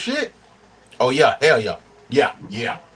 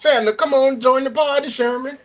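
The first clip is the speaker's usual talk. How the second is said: high, singsong dispatcher pitch; mock dispatcher call; 10 semitones higher than usual. Imitating a dispatcher calling a driver, high pitched pitch. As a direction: sell the load like a pushy dispatcher, pitched way up.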